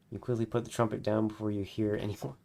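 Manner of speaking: ordinary delivery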